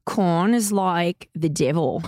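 put-on slow, drawn-out Australian accent. Cohost imitates the trainer on request, Australian accent. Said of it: "She said it in Australian accent. Can you do it?".

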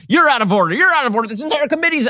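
hammed-up movie-lawyer outburst; Al Pacino courtroom rant. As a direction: play Pacino raging that the court is out of order. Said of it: "the sort of Al Pacino and Injustice for All".

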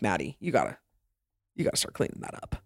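low, quiet, half-whispered advice; hushed friendly warning. Retold or imitated. Friends pull Maddie aside to warn her, hushed whisper. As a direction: lean in and whisper it like a secret.

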